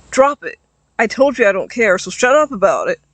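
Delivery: loud angry outburst; yelling. Acted. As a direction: snap it out, fed up. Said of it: "Arden yelled at him".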